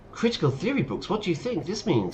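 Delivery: high, sing-song question; acted questioning. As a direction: put it to Kuno in a high theatrical voice. Reads a question to Kuno in character.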